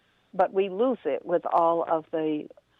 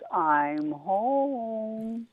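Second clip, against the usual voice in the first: very slow, unbothered turtle; drawn-out and content, in no hurry; slow calm turtle; 3 semitones higher than usual. Acted. Turtle turning down Rabbit, very slow and calm. Turtle's punchline in his shell.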